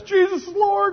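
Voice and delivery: high, loud mock proclamation; mock fervent confession. Preacher jokes about street conversion, mock fervent cry. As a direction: cry it out like an over-the-top convert.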